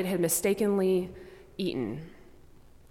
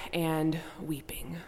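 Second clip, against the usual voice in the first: whisper; 2 semitones lower, 9 dB quieter, slower than usual. Real, not acted. Sermon ending on weeping Jesus.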